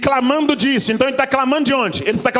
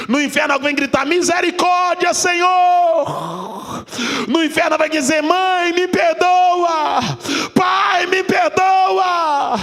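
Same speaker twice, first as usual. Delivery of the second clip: preaching at full force; 7 semitones higher than usual. Acted. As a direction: hammer each plea at the congregation. Preaches on pleas in hell.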